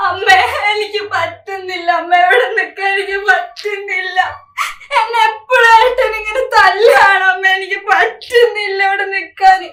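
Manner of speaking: crying through the words; sobbing plea. Acted. Daughter phoning her mother about beatings, sobbing.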